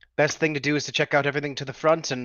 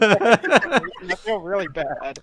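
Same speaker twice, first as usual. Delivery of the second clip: real laughter through the apology, higher and louder; laughing apology; 8 semitones higher, 9 dB louder, much slower than usual. Real, not acted. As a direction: apologize while cracking up for real. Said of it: "I'm sorry".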